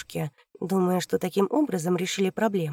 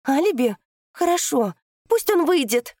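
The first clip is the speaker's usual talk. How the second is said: high, loud defiant cry; loud outburst; 8 semitones higher, 6 dB louder than usual. Acted. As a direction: shout the decision, rising higher. Daughter bursts out decisively.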